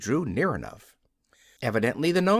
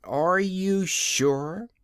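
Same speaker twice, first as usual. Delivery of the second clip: slow, higher stern question; 6 semitones higher, much slower than usual. A narrator's reading. Ozma questioning the Nome King, stern and slow. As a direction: press him slowly and sternly. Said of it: "asked Ozma sternly".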